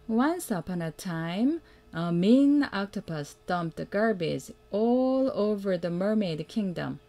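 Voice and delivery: plain reading voice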